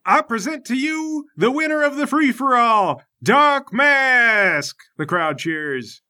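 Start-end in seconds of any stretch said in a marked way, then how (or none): 0.0-4.8 high ring-announcer proclamation; announcing judge. Acted. Judge presenting the winner to the crowd, grand announcement. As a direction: build to a shouted, stretched name.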